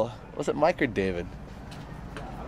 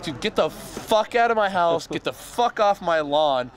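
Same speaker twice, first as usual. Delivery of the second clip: mild mock telling-off; 7 semitones higher than usual. Uncle ordering intruder away, retold.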